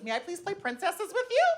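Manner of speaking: dainty, high-pitched polite request; polite little girl. Acted. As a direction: ask sweetly, like a kindergartner. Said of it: "she asked very politely".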